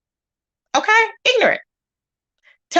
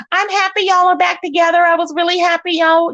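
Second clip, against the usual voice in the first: higher, with an audible smile; smiling voice; 5 semitones higher than usual. Real, not acted. Streamer congratulating the couple on stream, smiling and higher. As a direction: say it through a big smile, pitched up. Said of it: "Can you hear the smile in my voice?".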